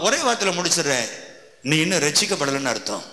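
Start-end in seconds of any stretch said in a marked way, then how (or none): none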